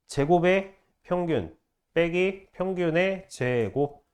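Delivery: slow, deliberate grouping of 제곱의 평균, 빼기, 평균의 제곱; phrased reading. Shown as a demonstration. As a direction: pause either side of 빼기, stretch the final 제곱. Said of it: "이렇게 읽는 거야".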